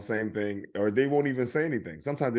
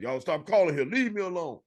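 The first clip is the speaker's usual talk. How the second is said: put-on higher, indignant old woman; fed-up old lady voice; 7 semitones higher than usual. Comedian voices an old lady scolding scam callers. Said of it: "I'll do that one".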